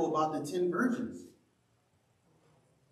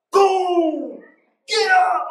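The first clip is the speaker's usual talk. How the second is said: very high, loud barked wake-up; hammed-up drill sergeant yelling wake-up; mock drill sergeant shout; 13 semitones higher, 10 dB louder, much slower than usual. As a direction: blast it like a sergeant banging cans. Drill sergeant waking recruits.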